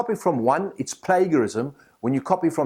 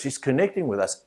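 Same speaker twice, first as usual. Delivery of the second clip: ordinary talk, nothing marked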